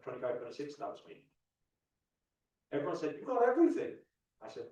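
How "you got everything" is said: high, loud exclamation of others' envy; emphatic amazement. Retold or imitated. Everyone telling the speaker how good life looks, emphatic amazement. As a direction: voice leaps up, insisting loudly.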